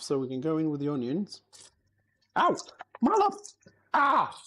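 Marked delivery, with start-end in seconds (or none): 2.4-2.6 sharp yelp of pain. Real, not acted. Burns finger on hot pan. 3.0-4.4 high yelp of sudden pain; pained outcry. Real, not acted. Burns finger, cries out.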